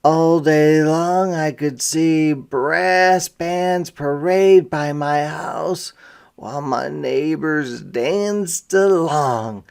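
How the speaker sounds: ordinary talk, nothing marked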